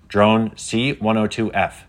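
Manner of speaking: slow, measured robot hail. A narrator's reading. Dex the robot addresses the FAA drone, robotic. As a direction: spell it out mechanically.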